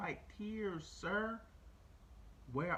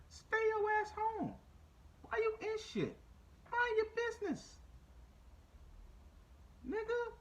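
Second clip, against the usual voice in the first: high, hammed-up yell, much higher than his talk; exasperated shout, pitched way up; mock yell at Kyle. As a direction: bark it like you're scolding him to his face. Yelling at Kyle from afar.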